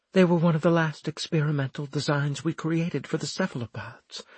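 lightly voiced Florimel. Florimel's voice in narration.

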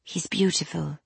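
soft and hushed, tender. Acted. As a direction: say it softly, moved. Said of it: "Juliet's voice was soft".

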